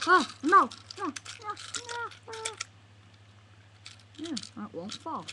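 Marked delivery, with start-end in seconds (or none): 0.1-2.3 loud, high repeated protest; high yelps of no; panicked cries. Acted. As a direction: cry out in alarm, over and over. Panics at a mishap.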